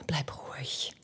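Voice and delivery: breathless hush, barely audible; whisper. Acted. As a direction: hush him with a near-silent whisper. Calming him, then repeating.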